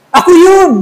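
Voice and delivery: high, loud "I did it!"; proud confession. Retold or imitated. Robber admitting to the owner he was the one, loud. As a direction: blurt it out boldly. Confession shouted in retold scene.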